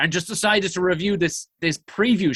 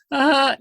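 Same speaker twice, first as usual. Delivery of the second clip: high, loud imitated wail; sudden high cry, put on; mock wail; 11 semitones higher, 5 dB louder than usual. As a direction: mimic a woman suddenly bawling. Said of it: "just starts wailing, crying".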